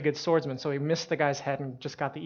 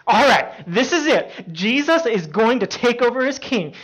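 high, loud and eager for battle; fired-up excitement; 8 semitones higher, 10 dB louder than usual. Retold or imitated. Peter expecting Jesus to become king, fired-up excitement. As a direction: act out rallying to the big moment.

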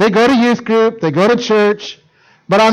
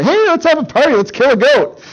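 mock party hype; 7 semitones higher than usual. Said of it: "Most of you ... go".

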